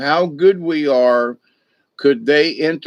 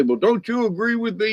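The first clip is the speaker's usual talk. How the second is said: high, fast pleading imitation; mock plea; 9 semitones higher, 4 dB quieter, faster than usual. Arguing person begging the moderator to take sides, high mock plea. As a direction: squeak out the plea for backup, quick. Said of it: "if they say".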